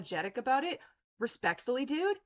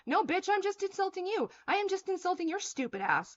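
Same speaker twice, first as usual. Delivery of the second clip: angry retort; 8 semitones higher than usual. Real, not acted. Snapping back at commenter.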